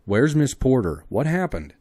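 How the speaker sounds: anxious man's loud demand; urgent questions. Acted. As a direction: press her for an answer. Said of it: "questioned Clayton".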